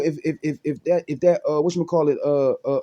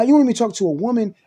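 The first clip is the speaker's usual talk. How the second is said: ordinary talk, nothing marked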